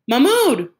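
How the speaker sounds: high, loud call of his name; breathless call. A narrator's reading. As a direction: call out after your brother. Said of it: "panting as he ran up alongside his brother".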